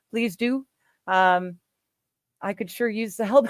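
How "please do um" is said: ordinary talk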